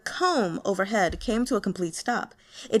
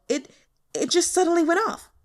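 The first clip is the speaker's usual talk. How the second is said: high, loud and fast, tripping over words; panicked stammer; 8 semitones higher, 5 dB louder, faster than usual. Acted. Scared intern explaining the misfire, fast panicked stammer. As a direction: blurt the excuse in a panic. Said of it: "Guo Changcheng yelped".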